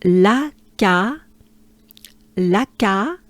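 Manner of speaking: La ca with open French a. Shown as a demonstration. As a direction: say La ca with a wide open a. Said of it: "starts like this".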